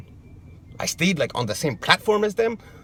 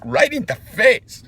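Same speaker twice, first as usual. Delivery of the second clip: indignant; 6 semitones higher, 6 dB louder, slower than usual. Real, not acted. Outraged about being hit.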